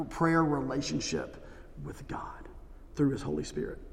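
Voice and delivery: a pleading whisper to the congregation; hushed whisper. Real, not acted. Pleading for a real prayer life.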